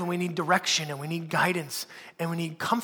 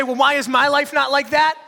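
mock envious whine; 6 semitones higher, 7 dB louder than usual. Mocks envious Instagram viewer.

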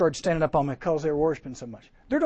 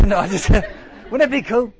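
giggling through the words; laughing; 4 semitones higher, 7 dB louder than usual. Real, not acted. Preacher laughing at his own joke to the campers, giggling. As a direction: laugh it off with glee.

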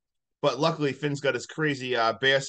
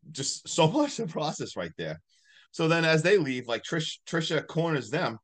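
ordinary talk

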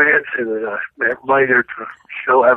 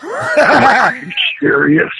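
stunned disbelief; 11 semitones higher, 3 dB louder, much slower than usual. Retold or imitated. Retold disbelief at Andre's prank.

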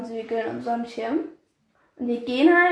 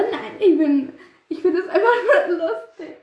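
words breaking into a higher giggle; real laughing; 5 semitones higher than usual. Real, not acted. Friend explaining she is not gloating, laughing. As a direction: insist through bubbling laughter. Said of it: "wieso ich lache".

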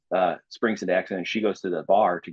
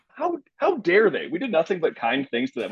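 mock outrage; 8 semitones higher than usual. Real, not acted. Outraged at the accusation.

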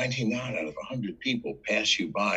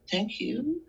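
meek thanks. Retold or imitated. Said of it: "I go".

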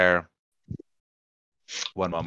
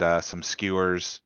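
ordinary talk

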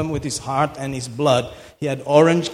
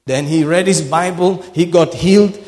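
loud, rising sermon fervour; full-force preaching; 3 semitones higher, 5 dB louder than usual. Acted. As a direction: preach it hard to the crowd.

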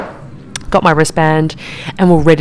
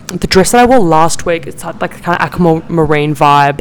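ordinary talk, nothing marked

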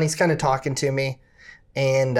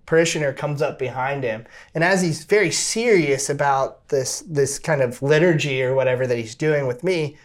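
ordinary delivery

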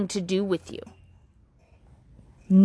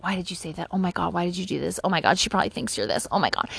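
much softer, lower, fast nervous muttering to yourself; anxious self-talk; 4 semitones lower, 6 dB quieter, faster than usual. Retold or imitated. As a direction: berate yourself under your breath. Replaying awkward moments at home.

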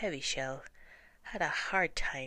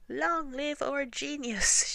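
much higher, louder, slow triumphant shout; shouted cheer; 11 semitones higher, 7 dB louder, slower than usual. Acted. Mice hailing the young mouse, slow triumphant shout. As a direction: hail the hero at full voice. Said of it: "shouted the mice".

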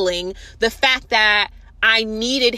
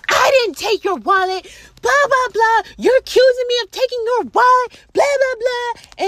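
high, loud mock whine of the boyfriend; shrill mocking imitation of a defensive boyfriend; mocking whiny denial; 13 semitones higher, 4 dB louder than usual. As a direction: parody him whining that she accuses him.

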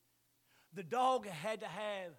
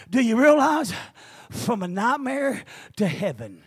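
preaching shout; 3 semitones higher, 22 dB louder, slower than usual. Real, not acted. Preaching climax, loud.

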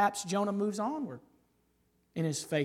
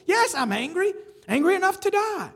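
loud, high, bitter outburst; angry Jonah; 12 semitones higher, 6 dB louder than usual. Acted. Preacher voicing Jonah's angry answer to God, loud and high. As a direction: spit it out like a sulking prophet.

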